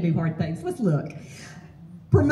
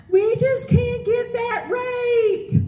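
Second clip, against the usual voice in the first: whiny client; 11 semitones higher, much slower than usual. Retold or imitated. Client pushing back on rent.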